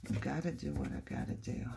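low, soft, quick self-talk; resigned. Real, not acted. Crafter accepts she must glue, quietly resigned. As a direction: say it quietly to yourself, giving in.

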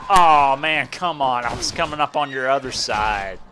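very loud, high exasperated yell; frustrated shout. Real, not acted. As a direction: shout it out, cheated. Knocked out, frustrated.

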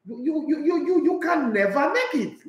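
high, stuttering put-down; mock Henry again. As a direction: jab each "you" before the verdict. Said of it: "that's what Terry Henry said".

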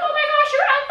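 high squeal of admiration; amazed praise. Retold or imitated. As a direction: gush like they're blown away. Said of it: "They're gonna be like".

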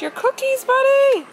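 surprised cheer; excited call. Real, not acted. Mom calls out to son.

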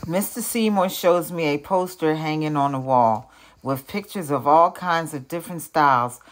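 ordinary delivery

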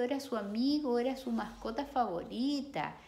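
ordinary delivery, nothing marked

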